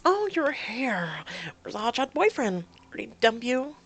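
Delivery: a high-pitched taunting sister; snide sister. Acted. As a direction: sneer at her from up high. Voices Kim.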